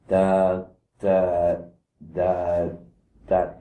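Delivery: low, slow, spaced-out da; heavy low-pitched da with pauses; sick, low vibration. Shown as a demonstration. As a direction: drop the voice low to sound sick and depressed. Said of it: "we have a day where we're sick, or we're depressed".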